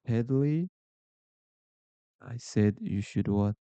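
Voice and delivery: ordinary talk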